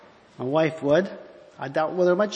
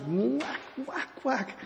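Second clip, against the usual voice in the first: rising mock smack noises, softer each time; whack sound effects; 8 semitones higher, 8 dB quieter, much slower than usual. Preacher retelling Balaam striking the donkey, mock whacks. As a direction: act out the donkey's beating with little whacks. Hitting the donkey three times.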